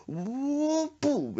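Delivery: high drawn-out whoosh, then a loud boom; slow-motion hit. Retold or imitated. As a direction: mimic a blow in slow motion. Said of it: "slow motion".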